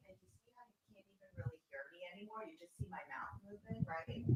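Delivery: barely audible, as if the mic has lost you; very faint, off-mic. Shown as a demonstration. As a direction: keep talking almost inaudibly, quick and distant. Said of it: "it was very, very faint".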